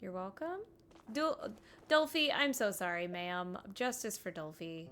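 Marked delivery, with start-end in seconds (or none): none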